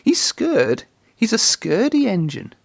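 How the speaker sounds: singsong taunt pitched high; mocking scaredy taunt; teasing. A narrator's reading. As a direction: tease him like a playground chant. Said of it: "teased Thomas".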